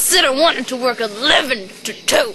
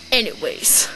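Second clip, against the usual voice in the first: ordinary talk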